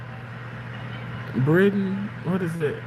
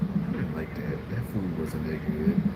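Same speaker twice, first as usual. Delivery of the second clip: low put-on voice; 10 dB quieter than usual. Voicing someone low.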